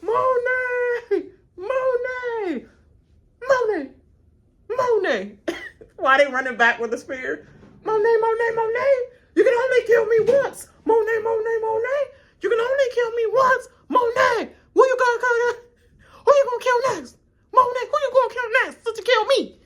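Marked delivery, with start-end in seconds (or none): 0.0-5.3 hammed-up annoying name call; mock yelling a name. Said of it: "I'd be like". 7.8-19.6 high, loud nagging shouts; hammed-up annoying taunts; taunting yell. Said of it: "I gotta be so freaking annoying".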